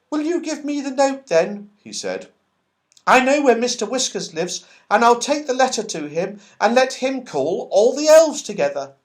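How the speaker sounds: lightly voiced high little squirrel; red squirrel offering to carry the note. A narrator's reading. Squirrel character speaking.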